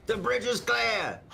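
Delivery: high, slow callout; calling out. Real, not acted. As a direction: shout it to the squad. Calling out to team.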